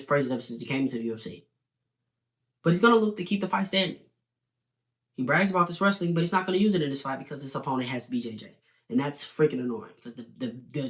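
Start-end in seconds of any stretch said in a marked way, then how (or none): none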